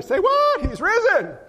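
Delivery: high, loud call and response; shouted call. Shown as a demonstration. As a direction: belt it out to the crowd. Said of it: "We'll put them together".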